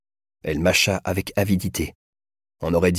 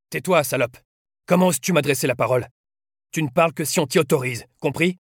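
raised pitch, stern scolding; angry dressing-down, pitched up; harsh rebuke; 7 semitones higher than usual. A narrator's reading. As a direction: put a prisoner sharply in their place. Slaps and rebukes the prisoner.

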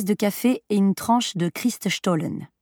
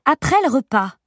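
lightly voiced mother, pitched up; mère qui répond; 6 semitones higher, 4 dB louder than usual. A narrator's reading. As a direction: answer a child with a higher voice. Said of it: "répondit-elle en le prenant par sa main libre". (mère qui répond à son enfant, voix plus haute)

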